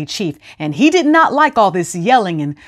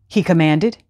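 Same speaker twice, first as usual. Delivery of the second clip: ordinary delivery, nothing marked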